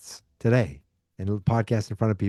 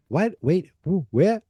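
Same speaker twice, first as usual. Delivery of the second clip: rising, higher and higher with each question; surprised; 8 semitones higher than usual. Retold or imitated. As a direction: caught off guard by the cameo.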